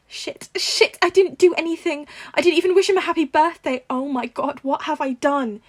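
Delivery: high, loud, frantic panic; tearful aunt panicking over a forgotten birthday, loud and high; panicked whimpering. A narrator's reading. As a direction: spiral into guilty panic. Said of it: "May whimpered, tears welling in her eyes".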